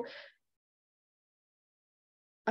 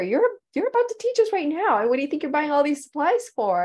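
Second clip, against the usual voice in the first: much higher, fast, incredulous; insistent friend; 7 semitones higher, 5 dB louder, faster than usual. Retold or imitated. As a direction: play the friend who can't believe it.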